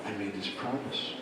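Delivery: hushed, low admission; ashamed confession. Acted. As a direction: confess very softly, head hung. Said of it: "She hung her head".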